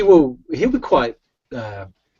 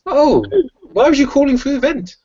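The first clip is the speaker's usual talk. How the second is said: high-pitched, louder questioning; puzzled query; 13 semitones higher, 5 dB louder than usual. Acted. Puzzled in-character question about the vent.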